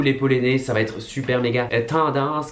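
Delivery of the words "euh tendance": lightly imitating a friend saying tendance; a hint of someone else's way of saying tendance; Christina impression. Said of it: "comme dirait Christina".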